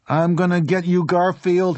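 high, loud angry threat; threatening boy. A narrator's reading. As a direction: threaten him loudly in a high boy's voice. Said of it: "Harry said".

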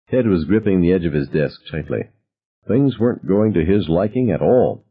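ordinary delivery, nothing marked

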